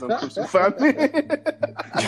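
high, slow, laughing plea. Real, not acted. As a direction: plead through laughter. Laughing after his bad accent.

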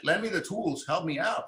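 usual speaking voice

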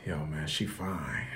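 low, slow, soft awestruck mumble; dazed by her, voice dropped low and hushed; nervous student mumbling low about the girl; smitten young guy. Retold or imitated. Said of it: "He's like".

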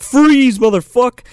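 high, loud acted command; mock cop shout. Acts cop storming in.

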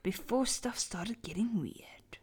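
hushed spooky storytelling; dramatic whisper. Acted. Reading a horror story aloud to friends, whispered. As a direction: whisper it to give chills.

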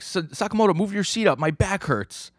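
high-pitched mock grumbling; whiny complaint. Man with sore back nagging front passenger, complaining. Character complains about cramped seat.

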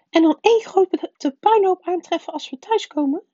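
high, loud indignant snarl; snarling aunt. A narrator's reading. Aunt Petunia rejects leaving the boy home, snarling. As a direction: throw it back high and loud. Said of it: "grauwde ze".

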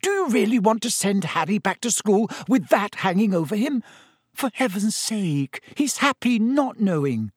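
high, pleading argument; shrill. A narrator's reading. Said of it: "said Missus Weasley shrilly".

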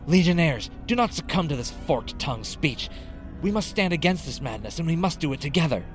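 high, urgent appeal, held back in volume; rallying cry. A narrator's reading. Sergeant rallies soldiers against herald.

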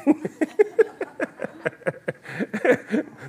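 a long, high burst of real laughter. Real, not acted. Laughing at brother-in-law's jibe.

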